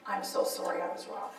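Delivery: low, soft, subdued apology; hushed, humbled retold apology; quiet apology. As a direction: say sorry almost under the breath. Reads friend's apology email.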